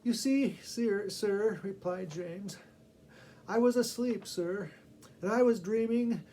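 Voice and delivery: nervous schoolboy. Acted. Boy excusing himself to teacher.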